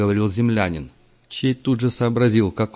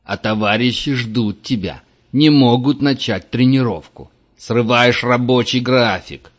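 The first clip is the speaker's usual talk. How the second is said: angry scolding; 2 semitones higher, 5 dB louder than usual. A narrator's reading. Тренер отчитывает игрока.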